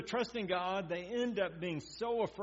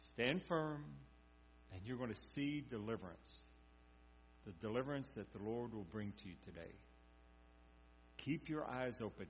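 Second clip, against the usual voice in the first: calm reassuring Moses; 7 semitones lower, 10 dB quieter than usual. Retold or imitated. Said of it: "Moses tells them".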